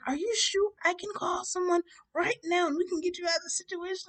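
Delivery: high-pitched earnest rescuer; concerned woman. Retold or imitated. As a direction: imitate a worried lady offering help.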